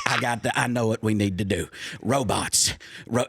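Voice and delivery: low drawling team owner; Jerry Jones impression. Pitching robots to the NFL as Jerry Jones, low. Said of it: "It turns into Jerry Jones".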